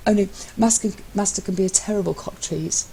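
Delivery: a hint of a sly, teasing sub; lightly voiced Matt. A narrator's reading. Matt needling his husband, lightly voiced sly tease.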